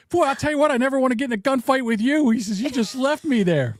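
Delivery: high-pitched, worked-up scolding; mock outraged high rant; imitated indignant uncle. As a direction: complain loudly at the one who deserted you. Retold indignant uncle after abandonment.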